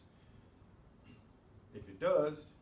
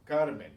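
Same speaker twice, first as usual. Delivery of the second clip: "Carmen" with a loud first syllable "Car"; stress on next-to-last syllable; 10 dB louder than usual. Shown as a demonstration. Teacher reads words ending in N, "Carmen" stressed on "Car". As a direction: punch "Car", let "men" fall in "Carmen". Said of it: "following the same rule".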